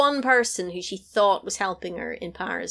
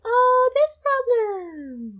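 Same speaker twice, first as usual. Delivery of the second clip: slow, high and mocking; high drawn-out dismissive voice; 4 dB louder, much slower than usual. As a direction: stretch it out in a high sneer. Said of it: "And then she was like".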